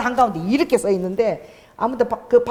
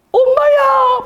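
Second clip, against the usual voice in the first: very high, loud Busan-dialect shriek of amazement; Busan dialect outcry; 6 dB louder, much slower than usual. Retold or imitated. Healed restaurant owner getting up after prayer, imitated as a Busan-dialect shout. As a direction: cry out in astonishment, imitating a Busan woman. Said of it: "부산 사투리로".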